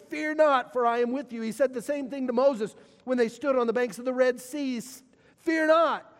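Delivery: rising, ringing pulpit voice; preaching. Acted. Pastor proclaiming God's promises to the congregation, fervent preaching. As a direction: proclaim each promise with full force.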